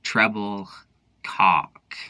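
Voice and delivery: slow, low, drawn-out Treble then Cock; spelled out Treble ... Cock, slow and low; savoured name. Shown as a demonstration. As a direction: relish each word with a pause between. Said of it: "Isn't that a fun one?".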